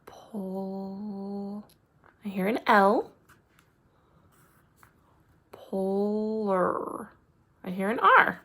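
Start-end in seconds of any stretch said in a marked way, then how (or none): 0.0-1.6 Pol- stretched slowly and very softly; sounding out words. Shown as a demonstration. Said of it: "I need to sound out the word polar". 5.5-7.1 Polar drawn out slowly, each sound joined; sounding out words. Shown as a demonstration. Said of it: "I need to sound out the word polar".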